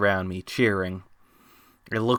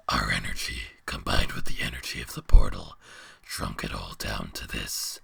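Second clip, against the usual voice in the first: very low, distant beast voice; deep monster voice; 12 semitones lower, 4 dB quieter than usual. Acted. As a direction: speak as a huge ancient beast. Beast explains.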